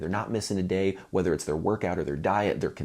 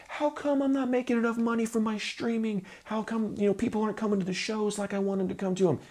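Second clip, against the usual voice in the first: whiny complaining musician. Retold or imitated. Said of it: "musicians who are like".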